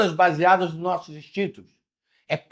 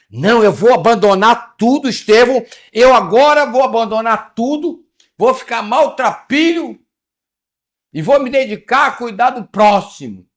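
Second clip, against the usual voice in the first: louder, higher, over-eager vow; mock zealous convert; 6 semitones higher, 8 dB louder than usual. Preacher mocks a follower vowing to abandon everything, loud and eager. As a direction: caricature someone swearing to give up everything.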